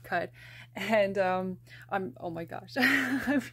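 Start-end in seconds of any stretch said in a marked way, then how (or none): 2.8-3.4 giddy laugh breaking into words; bubbling-over giggle; excited laugh. Real, not acted. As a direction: laugh it out with real glee. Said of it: "I'm bubbling over with excitement".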